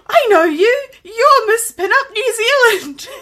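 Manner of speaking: high, loud imitation of an excited stranger; retold yell. Woman recognising the host at Kmart checkouts, retold high yell. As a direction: holler it across the shop as the woman did. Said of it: "this woman yells".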